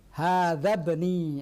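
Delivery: "bni" with the i of ibnun dropped after "Hadza", very slow; model pronunciation. Shown as a demonstration. Teacher showing students how the hamzah drops, slow and deliberate. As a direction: glide "Hadza" straight into "bni", no alif sound, drawn out slowly. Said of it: "Bagaimana ibnun?".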